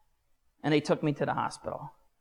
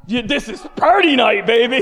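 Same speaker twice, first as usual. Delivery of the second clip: loud, high party-guy holler; party whoop; 7 semitones higher, 10 dB louder than usual. Retold or imitated. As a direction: whoop it up like the night is yours. Reliving wild party excitement.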